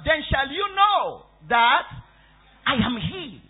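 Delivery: slow, loud and high, pause before "I am he"; emphatic declaration. Real, not acted. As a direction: proclaim "then shall you know that", then set "I am he" apart. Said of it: "Again, emphasizing that he is the one".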